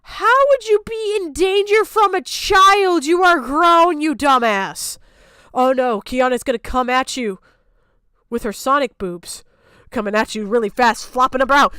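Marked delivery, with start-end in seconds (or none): none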